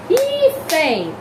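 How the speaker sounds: "hífen" with a high, loud, long HÍ; exaggerated penultimate stress. Shown as a demonstration. Teacher shows the tonic syllable, high loud HÍ in "hífen". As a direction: punch and hold HÍ in "hífen", letting FEN drop. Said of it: "Aí a penúltima".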